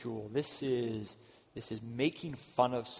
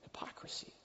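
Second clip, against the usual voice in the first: breathy whispered word; 16 dB quieter than usual. Real, not acted. Preacher naming the sin in a whisper. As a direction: hush the word like a confession.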